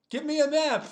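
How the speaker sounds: high, loud mock demand; acted demand. Retelling a character demanding a map, high loud mock demand. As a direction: pitch it up and demand it loudly.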